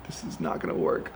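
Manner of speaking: whispered, low dismay; whispered aside. Retold or imitated. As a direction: whisper it to yourself in panic. Panicked aside at the fire.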